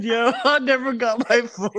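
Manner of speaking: high, laughing retelling; in tears laughing. Real, not acted. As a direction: retell it while still cracking up. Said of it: "I'm in tears".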